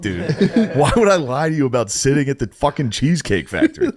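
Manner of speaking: high, laughing exasperation; laughing disbelief. Retold or imitated. Confronting the waiter, high laughing disbelief. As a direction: laugh at the absurdity of it. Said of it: "I was, like, laughing at that point".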